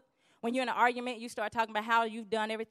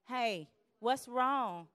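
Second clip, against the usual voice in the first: drawn-out plea; much slower than usual. Retold or imitated. Wanting to be asked.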